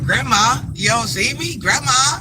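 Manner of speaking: put-on high, loud little boy calling out; grandson's voice. Grandson calling to grandma from the street. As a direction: call out like a small grandson. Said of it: "He was like".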